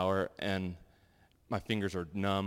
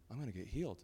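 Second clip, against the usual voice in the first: quiet certainty; 11 dB quieter, faster than usual. Retold or imitated. Quiet inner certainty in prayer.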